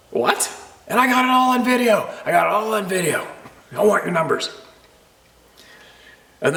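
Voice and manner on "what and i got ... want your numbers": much higher, hammed-up irate voice; irate canoe guy threatening; mock angry stranger. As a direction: imitate him waving his video.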